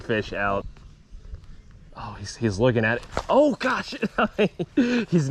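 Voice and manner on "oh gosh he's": excited squeal. Real, not acted. Fish nipping at the bait.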